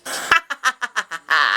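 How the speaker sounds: staccato gloating cackle; triumphant laugh. Acted. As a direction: laugh it out, getting the last laugh. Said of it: "always gets the last fucking laugh".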